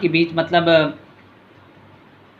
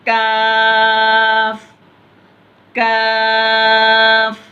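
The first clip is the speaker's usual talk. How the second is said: slow, high, louder chanted lam alif; drawn-out recitation; 6 semitones higher, 5 dB louder, much slower than usual. Shown as a demonstration. Teacher demonstrates the lesson's letters to pupils, slow chanted and louder. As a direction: hold लाम very long, then a falling अलिफ. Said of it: "इसको भी वैसे पढ़ना".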